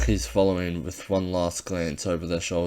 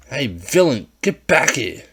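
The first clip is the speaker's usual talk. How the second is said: loud, higher bully yell; calling out; 6 semitones higher, 8 dB louder, slower than usual. Acted. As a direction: yell after him.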